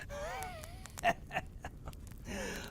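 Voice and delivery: a wild high shrieking laugh; manic cackle. Acted. Prisoner cackling at his interrogators, manic. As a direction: break into a mad cackle.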